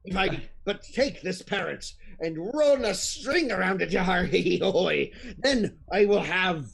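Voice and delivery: rough pirate ogre, chuckling hee hee hoy. Acted. Ogre gleefully planning a parrot on his shoulder, hammed pirate voice. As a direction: ham up the greedy pirate ogre.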